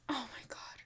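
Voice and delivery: breathless whisper; whispered shock. Real, not acted. Reader stunned by what the cards show, whispering. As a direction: barely breathe the words out in disbelief.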